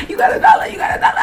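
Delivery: very high, squeaky begging imitation; crackhead beggar. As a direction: mimic a pestering street beggar. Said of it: "there's this crackhead outside".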